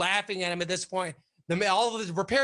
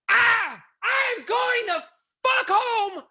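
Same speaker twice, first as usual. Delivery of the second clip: imitating a furious screaming exit; 10 semitones higher than usual. Boss screaming at his staff as he storms home, furious. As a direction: scream threats while storming off.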